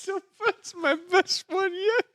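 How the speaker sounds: squeaky, high words squeezed through laughter; helpless laughter. Real, not acted. Host cracking up at his own botched name, helpless laughter. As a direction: talk while cracking up, voice shooting high.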